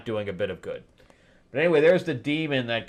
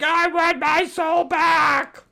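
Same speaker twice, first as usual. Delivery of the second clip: very high, slow shouted demand; demon shouting; 5 dB louder, slower than usual. Retold or imitated. Demon shouting for his soul in battle. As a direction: shout it like a desperate monster. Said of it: "and he's like".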